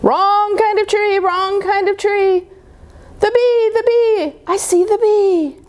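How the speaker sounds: high, eager cub calling out; little cub delighted to see the bee; excited child bear. Acted. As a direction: squeal it out, spotting the bee. Small Bear spots the bee again.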